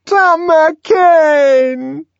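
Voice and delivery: very high, long drawn-out call; anguished cry. A narrator's reading. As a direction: reach for him with the name. Linda crying out again.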